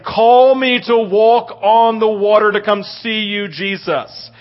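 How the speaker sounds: loud, slow rising preacher's climax; preaching at full force. Acted. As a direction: build the sermon to a stirring peak. Preaching climax about Peter.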